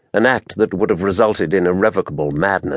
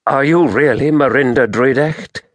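higher-pitched, insistent questioning; demanding; 6 semitones higher, 3 dB louder than usual. A narrator's reading. Taras doubts that it is really Merinda, demanding. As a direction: press for the truth, rising at the end. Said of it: "he demanded".

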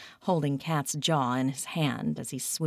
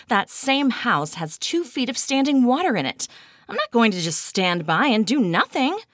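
heated and loud, higher; mad; 8 semitones higher, 6 dB louder than usual. A narrator's reading. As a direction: push it out angrily. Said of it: "You sound mad".

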